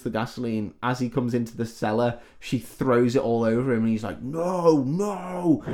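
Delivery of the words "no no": mock Freddy protest. Freddy doused in gasoline.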